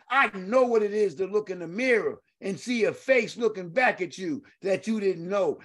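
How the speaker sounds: ordinary talk, nothing marked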